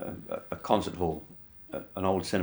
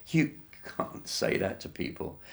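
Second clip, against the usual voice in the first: whispered splutter of disbelief, softer; spluttered under breath; 4 semitones higher, 5 dB quieter than usual. Retold or imitated. Bandmate at side of stage, whispered splutter. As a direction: hiss it to yourself in shock. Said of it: "spluttering to myself".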